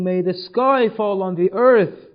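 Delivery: mildly acted cry of grief; retold anguished cry. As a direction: call it out as an impassioned curse. Zainab's anguished curse, retold.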